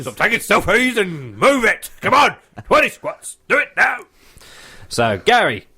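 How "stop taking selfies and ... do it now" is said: personal trainer. Pretend. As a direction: push them hard, shout it out. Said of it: "your personal trainer impression".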